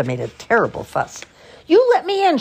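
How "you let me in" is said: high, loud indignant shout; shouted demand. A narrator's reading. Furious Martha outside George's secret clubhouse, shouting. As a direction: shout it to be let inside. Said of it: "she shouted".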